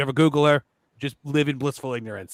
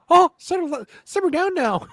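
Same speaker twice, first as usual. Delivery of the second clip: high, hammed-up character voice; imitating a catchphrase; 4 dB louder than usual. Demoing his catchphrase to the co-host, high and goofy. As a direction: pitch it way up in a goofy scold. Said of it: "all I ever say is".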